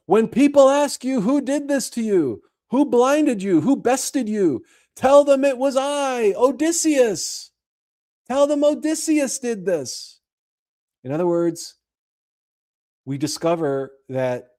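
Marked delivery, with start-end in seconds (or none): 0.1-10.2 higher, proud mock shout; shouting back. Odysseus taunting the Cyclops from his ship, higher proud shout. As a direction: boast it across the water. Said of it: "he shouts back".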